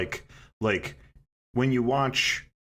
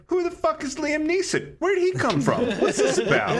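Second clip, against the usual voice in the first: much higher, laughing disbelief; baffled outrage; 4 dB louder than usual. Retold or imitated. Fan meeting a new character, high laughing disbelief. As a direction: squeal it out as a confused fan, cracking up. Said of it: "you're like".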